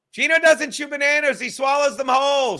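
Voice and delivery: high, loud declaiming; over-the-top reading. Shown as a demonstration. Host reading out a comment about Gino, over-the-top. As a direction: read it out in a high, pushed voice. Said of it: "why am I reading it like that".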